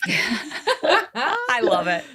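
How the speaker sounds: laughing through the words; high laughing, very slow. Real, not acted. Host cracking up at the fourth child joke, laughing.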